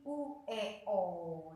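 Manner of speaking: ordinary delivery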